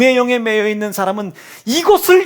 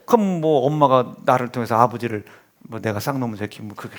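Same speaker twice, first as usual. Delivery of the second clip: ordinary delivery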